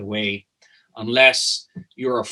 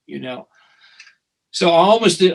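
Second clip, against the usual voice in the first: ordinary delivery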